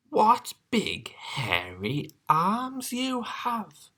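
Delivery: high, slow and wondering. Acted. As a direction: draw it out in awe.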